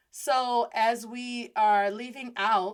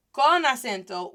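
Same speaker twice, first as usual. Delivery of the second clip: ordinary delivery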